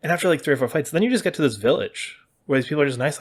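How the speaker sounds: ordinary talk, nothing marked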